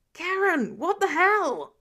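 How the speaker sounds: high, loud yell of disbelief; exasperated shout. Acted. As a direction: throw the outrage at her. Foggy shouting at Karen.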